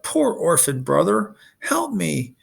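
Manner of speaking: mock wail. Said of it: "Polyphemus wailed".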